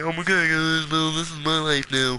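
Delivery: whiny pretend sob, hammed-up; badly faked crying voice; mock crying. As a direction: fake a tearful lament to the viewers. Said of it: "you'd probably hear me crying".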